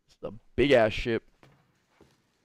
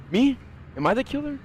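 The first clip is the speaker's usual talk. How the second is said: mock panic; 11 semitones higher, faster than usual. Mock panic at a horror game.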